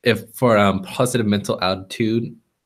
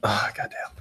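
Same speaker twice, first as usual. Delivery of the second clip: whispered curse; 7 dB quieter than usual. Real, not acted. Muttered curse in library.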